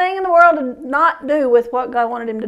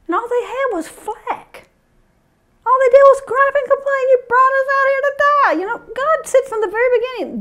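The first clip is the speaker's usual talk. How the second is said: much higher, fervent preacher cry; preaching at full force; 12 semitones higher than usual. Acted. Preacher telling the congregation about the elders' complaints, impassioned.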